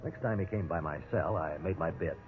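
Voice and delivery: low, soft, measured radio narration; story narration. A narrator's reading. Prisoner narrating his ordeal to listeners, hushed and low. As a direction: tell it looking back, quietly.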